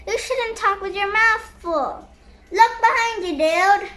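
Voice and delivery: plain reading voice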